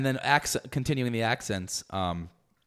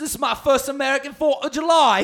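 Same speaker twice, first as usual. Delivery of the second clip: high, hammed-up English accent; exaggerated English "Oi", pitched way up; mock English accent; 12 semitones higher, 5 dB louder than usual. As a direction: proudly announce it in a squeaky English accent. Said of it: "make up with the English accent".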